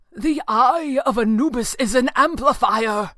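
loud, high, urgent plea; desperate cry. A narrator's reading. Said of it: "Falcone cried desperately".

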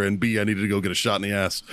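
ordinary delivery

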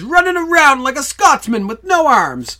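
loud, high, slow complaint from a mom; acted movie mom. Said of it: "and his mom says".

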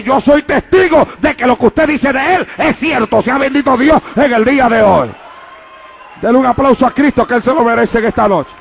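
rising, emphatic pulpit delivery; preaching at full force. Real, not acted. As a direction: proclaim it to the whole church. Predica a plena fuerza. (predicando con fervor a plena voz)

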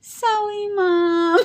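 put-on squeaky toddler voice, sorry said as "sowwy"; clueless little child. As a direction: apologize like a tiny tot copying big brothers. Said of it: "And then little Sonny, he has no idea".

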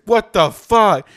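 drawn-out, high, loud shock at the burn; imitating a friend yelling in pain; pained outburst. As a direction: blurt it slow and loud like your mouth's on fire. Anthony bursts in from hot sauce.

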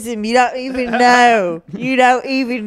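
indignant Londoner; British accent. Acted. Continues British character bit.

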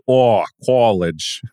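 slow, drawn-out Boston aw on college; teasing Boston drawl on aw; Boston accent. Retold or imitated. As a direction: mimic a friend's Boston accent. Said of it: "Whenever Kevin says an O, like an aw".

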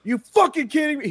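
mock outraged shout; retold angry outburst; mad disbelief. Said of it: "He got so fucking mad".

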